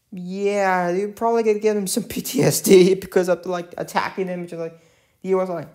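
ordinary talk

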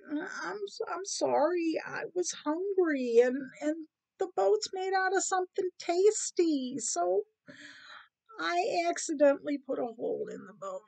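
small high voice, sheepishly owning up; apologetic rat. Acted. Rat confesses to cat about the hole, sheepish.

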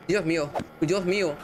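normal talking voice

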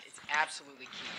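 ordinary delivery

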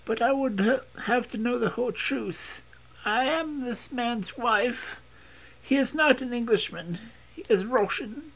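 put-on very high, foreign-accented woman's voice; foreign woman confessing. A narrator's reading. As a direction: confess it all in a sustained high voice.

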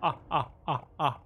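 usual speaking voice

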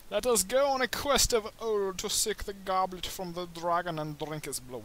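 loud, high grand proclamation; mock epic herald. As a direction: announce the quest like a fantasy hero.

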